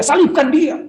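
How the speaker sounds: high-pitched imitated mob cry; retold high shout; shouting crowd. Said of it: "Ketika orang teriak-teriak".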